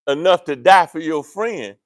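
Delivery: ordinary delivery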